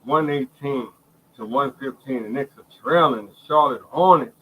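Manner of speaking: normal talking voice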